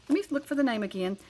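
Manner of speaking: normal talking voice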